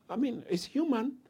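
ordinary talk

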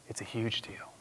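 hushed, breathy emphasis; whisper. Real, not acted. Preacher whispering to the congregation. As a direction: drop to a whisper for weight.

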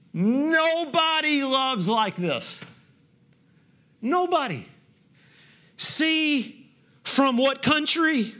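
high, drawn-out exclamation; slow, soaring pulpit emphasis; emphatic preaching. Real, not acted. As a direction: proclaim it in amazement, stretching the words.

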